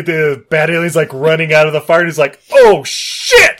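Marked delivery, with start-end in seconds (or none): 2.5-3.6 high, loud mock-panic shout; hammed-up "uh-oh" yell of sudden alarm. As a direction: play a hero caught off guard. Acted hero's panic at running alien.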